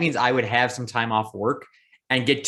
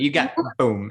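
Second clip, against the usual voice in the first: ordinary talk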